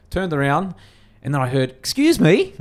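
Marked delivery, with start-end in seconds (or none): 1.7-2.5 high, loud mock-offended woman; offended challenge. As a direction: imitate an affronted customer calling him out, pitched way up. Quoting lady taking offence behind him.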